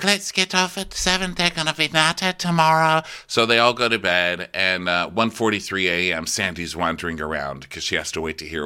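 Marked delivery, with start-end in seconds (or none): 0.0-3.0 high, light falsetto; pitched-up imitation of a crewmate; put-on crewmate. Crewmate planning for tomorrow, high imitation voice. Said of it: "so she's like".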